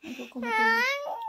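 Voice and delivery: high, loud whining cry; mock whine. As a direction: whine it like a sleepy child. Mock whine repeating line. (mataas at malakas na kunwaring ungot)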